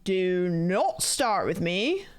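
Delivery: very slow attempt at Australian, sliding British; attempted Australian accent. American streamer mimicking Australian friend's phrase, shaky Australian accent. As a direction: draw out the Australian vowels, slowly. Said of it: "That's British".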